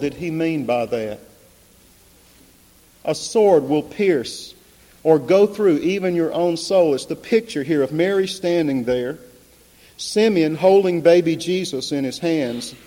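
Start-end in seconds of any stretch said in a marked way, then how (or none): 3.0-12.7 raised, emphatic pulpit voice; impassioned preaching. Real, not acted. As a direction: drive each phrase home to the congregation.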